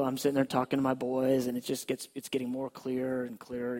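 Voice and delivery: normal talking voice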